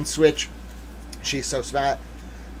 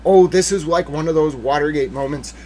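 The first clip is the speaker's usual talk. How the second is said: ordinary talk, nothing marked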